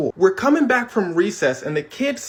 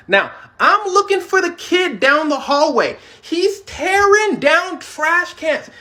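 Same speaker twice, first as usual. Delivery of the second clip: dramatic build; 10 semitones higher, 3 dB louder, slower than usual. Real, not acted. Dramatic storytelling.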